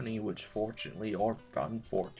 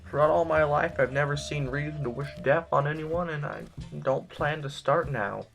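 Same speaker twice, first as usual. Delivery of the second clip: higher, firm denial; raised-voice avowal; earnest protest; 5 semitones higher, 5 dB louder than usual. Acted. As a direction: insist on it with raised pitch. Indignant denial of wishing death.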